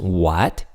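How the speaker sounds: WHAT rising like a question; questioning rise. Shown as a demonstration. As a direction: ask WHAT with the pitch climbing. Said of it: "like asking a question".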